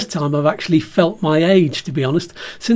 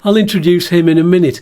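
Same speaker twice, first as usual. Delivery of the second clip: normal talking voice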